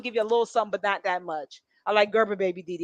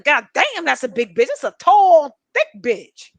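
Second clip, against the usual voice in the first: loud, rising exclamation of shock; loud shocked gossip remark; startled exclaim; 4 semitones higher, 9 dB louder, slower than usual. Retold or imitated. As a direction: blurt it out loud at the sight. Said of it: "I said".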